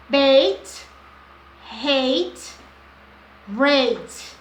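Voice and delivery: Bait, hate, Rate with a long "ei" vowel, loud and clear. Shown as a demonstration. Teacher demonstrating the long A vowel to learners. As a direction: stretch the "ei" in each word. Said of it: "o som longo da vogal A".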